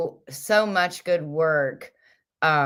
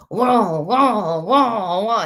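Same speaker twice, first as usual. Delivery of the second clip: loud, higher repeated "wah" like a hyper chicken; jittery bird squawk; 5 semitones higher, 4 dB louder, much slower than usual. Retold or imitated. Retelling the coffee-chicken video, squawking like the bird. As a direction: mimic a bird buzzing on coffee. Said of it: "the one that had coffee was like".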